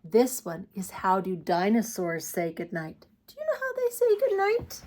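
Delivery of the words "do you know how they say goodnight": playful high voice. Acted. Playful question to child viewers.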